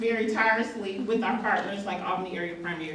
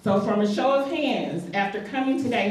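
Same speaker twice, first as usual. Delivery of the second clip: normal talking voice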